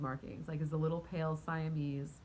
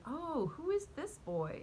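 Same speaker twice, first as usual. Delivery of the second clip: put-on high, slow, smitten "who is this boy"; delighted; 9 semitones higher, slower than usual. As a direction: coo over a new cat. First sees the cat.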